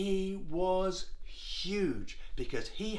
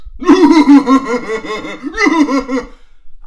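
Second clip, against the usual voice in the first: huge, loud, high booming guffaw; giant's mocking laugh; 8 semitones higher, 18 dB louder than usual. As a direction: laugh uproariously as Goliath. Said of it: "Goliath laughed".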